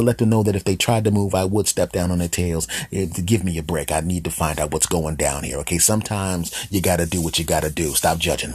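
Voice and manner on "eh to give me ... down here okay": ordinary talk, nothing marked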